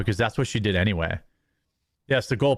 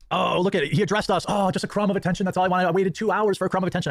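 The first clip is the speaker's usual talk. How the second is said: higher, whiny mock of a needy fan; 6 semitones higher, faster than usual. Mocking a needy viewer, higher and whiny. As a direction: sneer it like a desperate fan begging for notice. Mocking fans craving attention.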